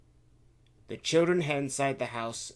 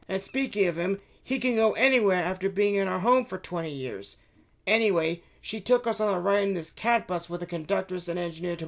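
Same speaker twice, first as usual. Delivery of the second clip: put-on higher, eager kid voice telling the adventure; excited child; 8 semitones higher, 8 dB louder than usual. Son telling his mother the adventure, eager and childlike. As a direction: rattle it off like an excited boy.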